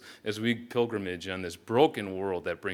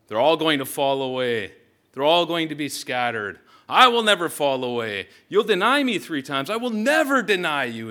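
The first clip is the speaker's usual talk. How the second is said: loud, higher, pounding repetition; drumbeat rhythm; 5 semitones higher, 8 dB louder than usual. Shown as a demonstration. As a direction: hammer each line like a drumbeat. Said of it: "I'm sure you can hear the, the drumbeat".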